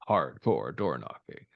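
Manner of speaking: low, slow announcer tagline. Shown as a demonstration. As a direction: drop it deep like a trailer. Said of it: "repeated in a new way".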